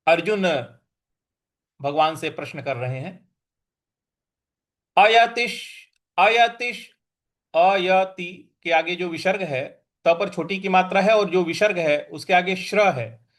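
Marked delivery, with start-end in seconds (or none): 5.0-6.9 अयतिश said with a clear sh at the end; visarga as sh. Shown as a demonstration. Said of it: "तो ये हो जाएगा अयतिश".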